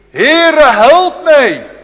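high loud pleading cry; desperate cry for help. Acted. Canaanite woman crying to the Lord, desperate loud cry. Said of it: "gebed van die Canaanese vrouw".

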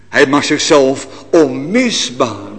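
normal talking voice

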